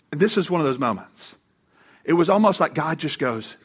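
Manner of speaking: normal talking voice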